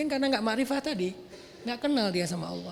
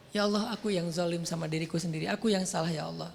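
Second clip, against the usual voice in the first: humble repentance; 5 semitones lower, 4 dB quieter than usual. Acted. Adam's repentant prayer.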